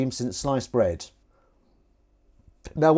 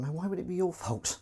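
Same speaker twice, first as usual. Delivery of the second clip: ordinary talk, nothing marked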